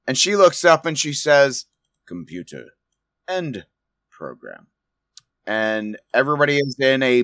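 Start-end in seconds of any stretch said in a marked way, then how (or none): 2.1-4.7 hushed, measured order to a computer; low, soft and spaced out; quiet command. Acted. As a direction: say it softly with deliberate pauses between words. Game master voices calm command.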